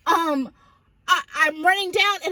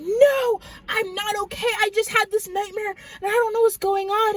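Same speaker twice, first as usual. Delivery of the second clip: frantic protest; 6 semitones higher than usual. Retold or imitated. Child telling parents about the nightmare, frantic. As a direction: re-enact a distressed child, pitched up.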